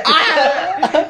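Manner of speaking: high, loud scream of pain; hammed-up pained yelp; retold scream. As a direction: yell out as if just twisted, half laughing. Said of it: "Y grité".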